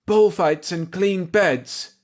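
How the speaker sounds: high, slow excited outburst; bursting out. Acted. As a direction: blurt it out giddily. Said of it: "she burst out with".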